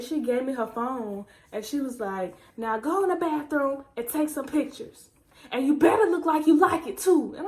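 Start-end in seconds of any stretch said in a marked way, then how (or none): 2.6-7.3 louder, bossy mom order. Retold or imitated. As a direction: demand photos like mom.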